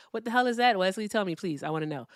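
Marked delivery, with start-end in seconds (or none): none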